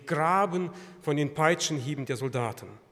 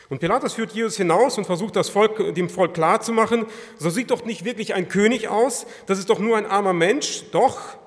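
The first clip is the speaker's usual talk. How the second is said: loud, raised, urgent retelling; impassioned preaching; 6 semitones higher, 10 dB louder than usual. Real, not acted. Preacher retells Pilate pleading with the crowd, impassioned. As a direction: tell it forcefully from the pulpit.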